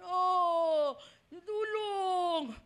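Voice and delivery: mock sobbing plea for help; imitated crying call for help from hell. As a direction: whimper out a plea as a suffering soul. Damned soul crying for help.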